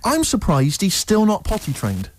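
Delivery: normal talking voice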